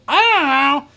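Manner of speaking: high mocking echo of the girl; mocking imitation. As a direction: parrot her dismissively. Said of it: "you cover it up with".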